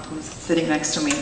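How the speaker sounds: usual speaking voice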